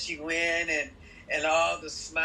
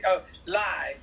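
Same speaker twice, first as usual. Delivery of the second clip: ordinary delivery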